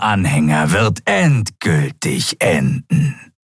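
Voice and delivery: reptile prisoner, slow and fanatical; prophesy their end with cold menace; menacing prophet. A narrator's reading.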